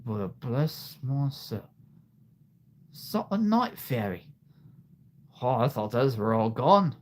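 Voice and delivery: lightly voiced dragon trapper; a hint of a trapper admiring his catch; Eret. Trapper eyes a night fairy, lightly acted. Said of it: "This is Eret".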